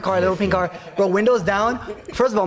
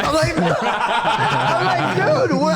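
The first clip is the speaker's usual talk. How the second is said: slow, high laughing disbelief; 6 semitones higher, slower than usual. Retold or imitated. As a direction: squeal it out through laughter. Retold panic in fast car.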